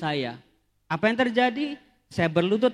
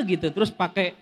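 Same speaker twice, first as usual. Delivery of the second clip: ordinary talk, nothing marked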